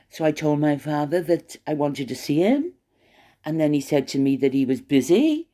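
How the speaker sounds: every sentence ends on a rising tune, like a question; mocking uptalk. Mocking Harry's upspeak as he explains his father. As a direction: lift the end of each line to mock the upspeak. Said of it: "go down at the end".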